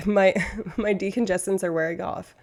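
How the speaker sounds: usual speaking voice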